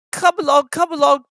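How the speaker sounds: high, loud fussy engine; fussing. A narrator's reading. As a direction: fuss them along, high. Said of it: "he fussed".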